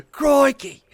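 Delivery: hammed-up Aussie "crikey", high and loud; big, badly faked Australian accent. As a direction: shout it like a mock Australian TV adventurer. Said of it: "That's Australian".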